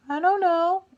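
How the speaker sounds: put-on very high, small child reply; squeaky high kid voice; kids' voice. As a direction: imitate a clueless little kid. Voices silent kids answering.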